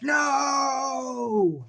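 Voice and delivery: high, loud cry of grief; anguished cry. Retold or imitated. Man watching his love struck down, anguished shout. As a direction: scream it as she falls. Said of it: "he's just like".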